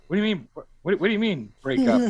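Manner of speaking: high, disbelieving protest; mock shocked boyfriend. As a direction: pitch up in stunned disbelief.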